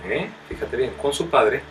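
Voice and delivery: loud, fervent sermon delivery; preaching at full force. Acted. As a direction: call out the verses to the congregation. Preaches the next verses loudly. (predicando con fuerza y fervor)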